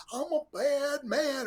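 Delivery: lightly voiced Dusty strutting; Dusty's bad man line. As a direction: drag out the boast like Dusty coaching a heel. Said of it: "He'd say things like".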